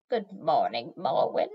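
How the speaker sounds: put-on low, rasping greeting from an old hag; croaking old witch. A narrator's reading. Old chair witch greets Morwen, croaking. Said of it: "Chair Witch Arshanis croaked".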